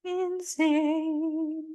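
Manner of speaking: very high, stretched-out "Insane"; drawn-out high squeal. Real, not acted. Vlogger reacting to the lovers' drama, high and drawn out. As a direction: draw the word out long on a high held pitch. Exaggerated repeat of her comment.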